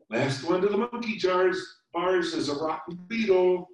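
high, playful dare; playful squeak. A narrator's reading. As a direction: tease a friend into a race, squeaky. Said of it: "squeaked Lindy".

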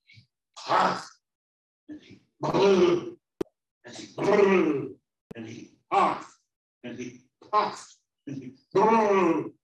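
explosive puffs, long high loud blows; strenuous huffing and blowing. A narrator's reading. Storyteller showing the wolf's efforts, slow and forceful. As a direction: act out each gust with the breath.

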